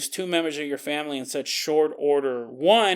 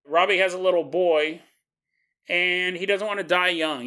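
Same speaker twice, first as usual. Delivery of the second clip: ordinary talk, nothing marked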